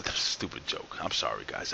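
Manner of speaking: quietly mocking your own joke; embarrassed whispered self-put-down; whispered aside. Real, not acted. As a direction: whisper it under your breath. Mutters aside about own joke.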